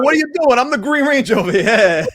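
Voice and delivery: high, loud joking New York Ranger voice; hammed-up tough New York guy; mock New York accent. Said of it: "Although that would be kinda cool".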